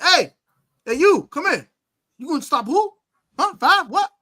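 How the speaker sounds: high, loud taunt; taunting call-out. Retold or imitated. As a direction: call him over and mock him. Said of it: "I said".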